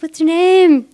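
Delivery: high, loud mock pickup line; eager flirting guy; flirty stranger. Stranger follows her asking her name, high mock flirt.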